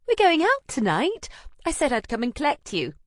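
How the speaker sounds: high, loud cheery friend voice; chirpy girlfriend calling up from the street; bright friend Anna. Acted. Anna explaining the plan.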